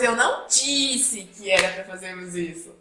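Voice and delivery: "disse" punched out after "Eu não"; stress on DISSE. Shown as a demonstration. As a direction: hit the verb "disse" hard and stretch it. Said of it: "como a entonação faz sentido".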